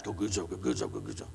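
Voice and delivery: low, soft grumbling mutter. Shown as a demonstration. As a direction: mutter it like a complaint. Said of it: "You can just hear the muttering".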